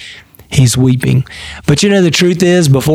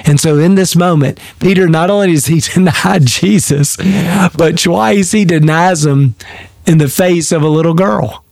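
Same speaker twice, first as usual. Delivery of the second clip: ordinary talk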